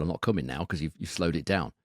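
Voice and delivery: normal talking voice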